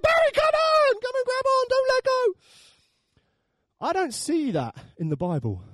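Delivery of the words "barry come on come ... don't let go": very high strained shout; hammed-up frantic high holler; desperate yelling. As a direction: scream encouragement to someone slipping. Churchgoers yelling to Barry on rope.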